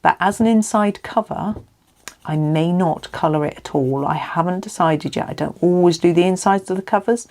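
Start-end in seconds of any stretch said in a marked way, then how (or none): none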